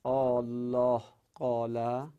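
الله قال with Āllah drawn out slowly; slow, elongated Āllah in الله قال; merged long vowel. Shown as a demonstration. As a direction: hold the first ā of الله long before قال.

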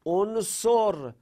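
drawn-out example word. Shown as a demonstration. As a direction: model the imperative slowly.

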